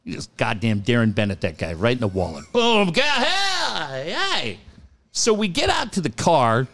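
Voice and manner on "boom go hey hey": high whooping sound effect; mock action yell. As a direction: act out the shove with a high cry. Acting out shoving man into wall.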